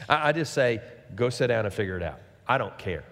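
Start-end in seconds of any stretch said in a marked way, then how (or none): 1.2-3.0 low, offhand brush-off; uninterested, understated dad; dismissive parent. Retold or imitated. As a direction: wave the kids away in a low voice. Voicing himself dismissing squabbling kids.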